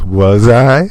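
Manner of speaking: a hint of coy sass; lightly voiced teasing question; lightly sassy "Was I?", imitating the girl. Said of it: "And that's the sass".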